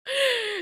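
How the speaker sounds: squealing high giggle. Real, not acted. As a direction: giggle helplessly.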